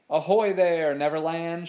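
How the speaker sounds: hearty ship-to-ship shout; shouted hail. A narrator's reading. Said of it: "shouted Smee to the other ship".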